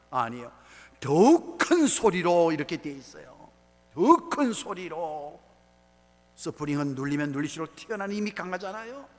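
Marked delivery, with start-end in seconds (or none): 1.0-2.5 loud, emphatic preacher's call; booming emphasis from the pulpit; crying out louder. Retold or imitated. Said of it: "더 큰 소리로 부르짖었다". 3.9-5.4 loud, slow, drawn-out call; booming preacher's emphasis; crying out louder. Retold or imitated. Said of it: "더 큰 소리로 부르짖었다".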